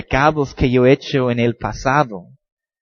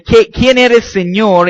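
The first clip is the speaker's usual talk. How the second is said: startled cry; 7 semitones higher, 5 dB louder, slower than usual. Acted. Saul answering the heavenly voice.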